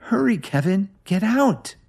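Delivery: high-pitched urgent call to her husband; wife shouting at her husband to get out. A narrator's reading. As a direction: yell the warning across the room. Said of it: "Lily shouted".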